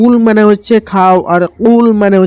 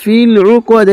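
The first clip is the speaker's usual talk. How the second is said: slow, higher melodic tajweed chant; Quran recitation; 3 semitones higher, much slower than usual. Shown as a demonstration. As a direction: recite it slowly, stretching the vowels. Teacher recites verse lines. (ধীর সুরেলা তিলাওয়াত)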